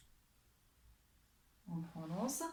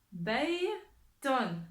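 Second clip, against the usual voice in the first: lecture appuyée; 2 semitones higher, 4 dB louder than usual. Shown as a demonstration. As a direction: split in two with a pause. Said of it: "on écoute bien la lettre". (lecture appuyée, syllabes séparées)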